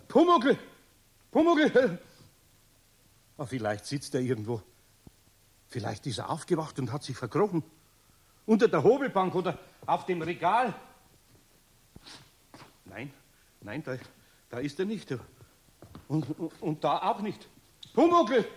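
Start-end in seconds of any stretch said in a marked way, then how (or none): 0.1-2.0 hoch und laut gerufen; rufen. Acted. Eder ruft ängstlich nach Pumuckl. 17.9-18.4 lautes, hohes Rufen nach dem Kobold. Acted. Eder ruft nochmal nach Pumuckl.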